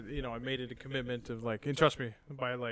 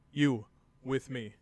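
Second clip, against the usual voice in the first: slow, louder stern order; stern command; 6 dB louder, slower than usual. Retold or imitated. Dad ordering his six-year-old to join, slow and stern. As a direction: clip each word like a drill sergeant.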